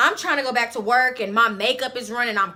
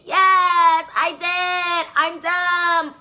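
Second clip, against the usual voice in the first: emphatic admission; 6 semitones higher, much slower than usual. Real, not acted. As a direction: own up to it, pitched right up. Owning up to taking him back.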